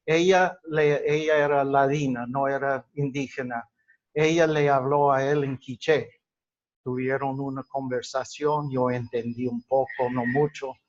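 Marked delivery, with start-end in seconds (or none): none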